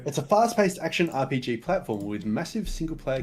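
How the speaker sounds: lively, sing-song ad copy read; promotional blurb read. Acted. Streamer reading a game's feature list aloud, promotional read. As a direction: sell it like a game trailer blurb.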